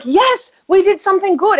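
high-pitched mock triumph; acted proud excitement. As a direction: celebrate a rare win, playfully. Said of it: "I'm like".

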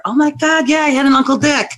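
high, excited mock phone gush; imitating excited client. Client calling the medium back, high excited gush. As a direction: gush like a client who finally remembers.

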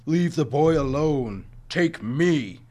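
slow, loud, pleading roar; a touch of a roaring tiger; desperate roar. A narrator's reading. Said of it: "the tiger roared desperately".

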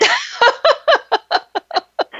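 a sudden high burst of laughter; big high laugh. Real, not acted. Guest laughing hard at her own trunk joke, high burst of laughter.

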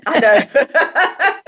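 I know, laughed out high; giggling while admitting it; laughing through the words. Real, not acted. Guest laughs about her accent.

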